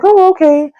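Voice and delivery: high, pleased acceptance; high delighted okay. Retold or imitated. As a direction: sound thrilled but polite. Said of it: "And I was like".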